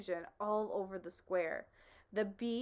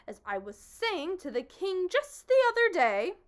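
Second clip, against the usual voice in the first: high, boastful bug voice; pompous humbug; 9 semitones higher, 9 dB louder than usual. Acted. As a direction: brag grandly as the old humbug.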